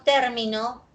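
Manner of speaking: "término" stressed on TÉR, the first syllable. Shown as a demonstration. Teacher contrasting accents for students, "término" stressed first. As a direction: hit the opening of "término": TÉR-mi-no. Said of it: "aquí observamos oposición del acento".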